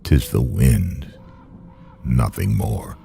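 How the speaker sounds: ordinary delivery, nothing marked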